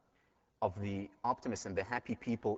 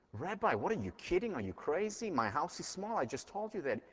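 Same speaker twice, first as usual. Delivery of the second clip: higher, half-mocking disbelief; a retold villager's exasperated outburst, pitched up high; incredulous protest; 10 semitones higher than usual. Villager protesting the rabbi's order, in pitched-up mock disbelief.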